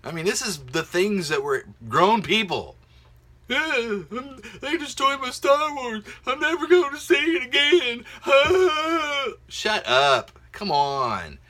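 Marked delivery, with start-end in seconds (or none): 3.5-8.0 very high, whiny fan wail, louder at the end; hammed-up crybaby fan; mock upset fan. Vlogger parodying fans who say Disney destroyed Star Wars, whiny wail. 8.1-9.4 high loud burst of laughter; real laugh at his own parody. Real, not acted. Vlogger cracking up at his own fan impression, laughing.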